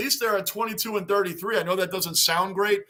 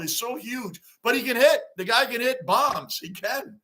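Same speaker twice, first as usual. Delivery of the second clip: normal talking voice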